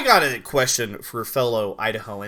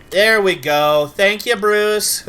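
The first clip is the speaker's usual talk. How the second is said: relieved cheer; 7 semitones higher, 10 dB louder, slower than usual. Real, not acted. Glitch finally works.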